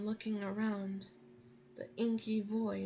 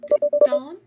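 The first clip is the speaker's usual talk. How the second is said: frightened, louder call; scared call; 11 semitones higher, 20 dB louder than usual. Acted. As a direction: call out nervously. Calling out in the dark.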